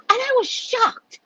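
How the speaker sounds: ordinary delivery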